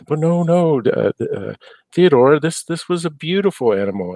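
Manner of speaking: "but no, no"; protesting husband. Acted. Insist gently that the animal was beautiful.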